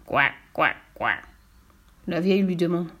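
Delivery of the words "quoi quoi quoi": low croaking frog voice, a bit deeper. Acted. Frog hopping after the pair, croaking. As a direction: croak out each quoi like a frog. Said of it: "une grenouille qui les poursuit".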